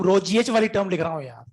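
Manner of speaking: normal talking voice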